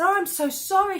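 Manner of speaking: high, loud retold apology; effusive apology. Retold apology to uncomfortable friend.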